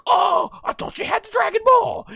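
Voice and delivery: high, loud gloating gotcha; mock him as he flies off; gloating Goku. Goku gloating at the kicked villain, high and loud. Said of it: "he's like".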